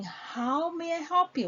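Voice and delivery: smiling, friendly offer of help; with a smile. Shown as a demonstration. Trainer showing how to offer help to a customer, smiling. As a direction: let the smile come through. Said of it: "with a smile".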